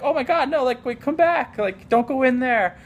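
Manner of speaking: high, mock-frantic calling out; panicked adults. Adults trying to stop the kids diving into the woods, high mock panic. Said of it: "They were like".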